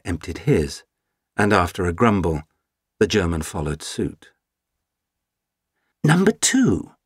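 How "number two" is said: eager Brit. Acted. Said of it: "asked the Brit".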